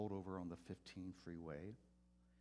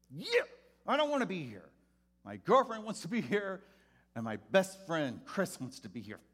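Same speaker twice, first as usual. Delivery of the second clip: much higher and louder mocking teen voice; surly tough teen; 10 semitones higher, 14 dB louder than usual. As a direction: sneer it like a kid who hates being there. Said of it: "That was his mentality".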